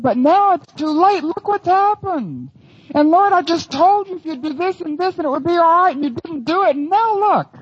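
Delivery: frustrated believer complains, mock reproachful; complaining to God. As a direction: chide the Lord for being late. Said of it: "You ever felt like saying that?".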